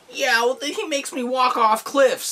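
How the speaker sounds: high, slow put-on turtle voice; drawn-out high character complaint; complaining Green Turtle. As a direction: whine it as an aggrieved cartoon turtle. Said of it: "The Green Turtles, like".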